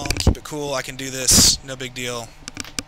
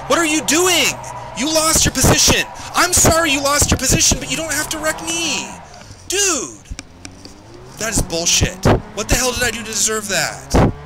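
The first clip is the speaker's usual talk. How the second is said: much higher and louder, real rage at another driver; furious yelling; 11 semitones higher, 6 dB louder than usual. Real, not acted. Racer yelling at the driver who wrecked him, furious shout. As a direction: scream it at the guy who just wrecked you. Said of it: "Sorry for yelling into the mic".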